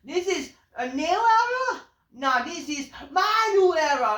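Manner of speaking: high, loud hammed-up promo voice; mocking promo line. Reviewer mocks "new era" catchphrase, high and loud. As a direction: belt it out high like a parody. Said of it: "and then, like".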